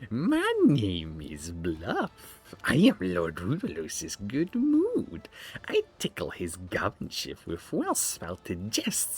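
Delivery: sly, slow court fool; a fool introducing himself to the lords, slowly; slow jester voice. Acted. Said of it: "I have to give him a [name] voice".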